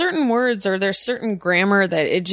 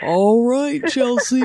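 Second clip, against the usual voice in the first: high, loud put-on sign-off; grandmother impression; 5 semitones higher, 4 dB louder, slower than usual. Guest imitating grandmother ending a call, high put-on voice. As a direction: sing out grandma's goodbye on the phone. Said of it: "That's how she ends every call".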